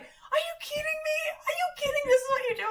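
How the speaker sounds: high, squealing mock yell of disbelief; incredulous husband. Husband aghast at her soaking her nails in foil, high mock shriek. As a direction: voice him cracking up in disbelief. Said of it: "And he's like".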